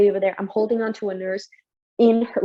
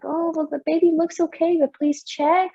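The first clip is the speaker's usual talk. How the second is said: husband's worried plea; 8 semitones higher than usual. Retold or imitated. Husband retold, pitched up.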